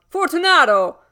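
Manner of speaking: loud, high call of a name; ringing full-voiced call; calling aloud. A narrator's reading. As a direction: shout into the niche for an answer. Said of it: "I called aloud".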